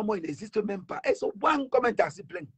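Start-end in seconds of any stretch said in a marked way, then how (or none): 0.0-1.0 mock indifference. Mocking the girls' indifference.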